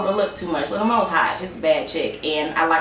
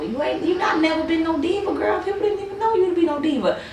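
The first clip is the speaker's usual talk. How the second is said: much higher, sassy put-down; sassy mock; 10 semitones higher than usual. Reviewer sassing a singer who is no diva, high and mocking. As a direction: talk down to her, high and mocking.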